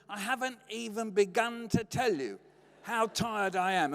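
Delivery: exasperated complaint. Retold or imitated. Dad competing with mum over tiredness, mock exasperation. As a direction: build the grievance word by word.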